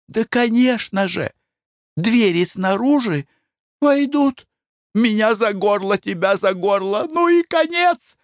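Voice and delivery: terrified wife. Acted. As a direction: paint the horror in panic. Wife imagines attack.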